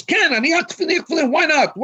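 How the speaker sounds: high, loud mock comeback; defiant shout. Friend retold shouting back that he will, defiant.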